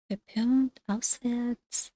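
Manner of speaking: usual speaking voice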